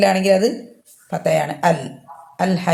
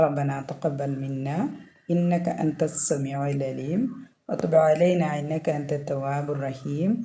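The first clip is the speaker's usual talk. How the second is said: slow, much softer prayer recitation; closing prayer; 3 semitones lower, 9 dB quieter, much slower than usual. Acted. Teacher ends the lesson with a soft, slow dua. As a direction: murmur the dua slowly and gently.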